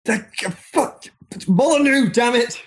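high-pitched comic outburst; mock exasperation. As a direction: curse him in pretend frustration. Mock outburst about Molyneux.

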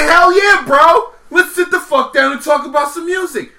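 loud, high, fired-up yell of agreement; hyped shout. Retold or imitated. Dude shouting yes to a dinner with music guys, hyped. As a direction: bellow it like the best offer ever.

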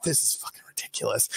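hushed, near-whispered retold aside; muttered disbelief. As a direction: mutter it under your breath, imitating your past self. Muttered reaction to being jailed.

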